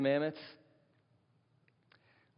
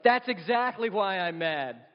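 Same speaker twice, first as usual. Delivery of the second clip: exasperated wife; 5 semitones higher, 4 dB louder, slower than usual. Retold or imitated. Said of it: "she's like".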